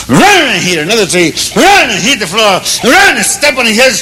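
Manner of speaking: high, rising shouts for each slam; hammed-up cartoon beating, building in pitch; acted-out thrashing. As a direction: make every blow a vocal crash. Acting out the elephant's beating.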